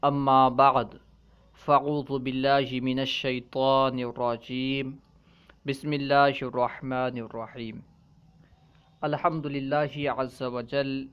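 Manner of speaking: low, measured Arabic recitation; Arabic opening recitation. Acted. Teacher opening class with praise and invocation, formal recitation.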